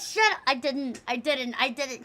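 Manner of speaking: usual speaking voice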